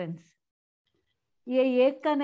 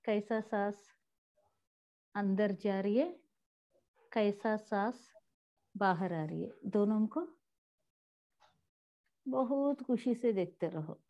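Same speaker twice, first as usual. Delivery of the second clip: guided meditation; 3 semitones lower, 3 dB quieter than usual. Real, not acted. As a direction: ease them gently into stillness, pausing between phrases.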